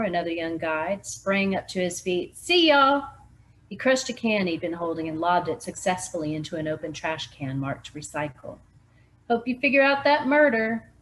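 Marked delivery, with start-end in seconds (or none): 2.4-3.1 loud cheery goodbye shout; bright hollered farewell; calling out. Acted. Young guide leaving, calls out. 9.3-10.9 loud, high parting jab; mock-cheery raised voice; teasing call. Guide teasing on his way out.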